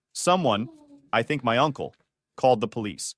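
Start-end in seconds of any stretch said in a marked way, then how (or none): none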